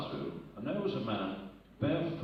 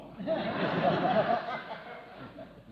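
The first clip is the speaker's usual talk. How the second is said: laugh; 9 semitones higher, 4 dB louder than usual. Real, not acted. Laughing at punchline.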